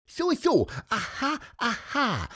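very high, piping little bird; squeaky bird voice. A narrator's reading. Pieper the bird muses to the children, high piping squeak. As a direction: chirp it out like a small bird. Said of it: "piepste Pieper".

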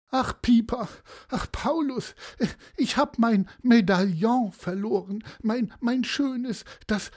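high, tearful grandma voice; sobbing old lady. Acted. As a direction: sob it out high, stumbling over words. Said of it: "schluchzte Oma Frerichs". (hohe, schluchzende Omastimme)

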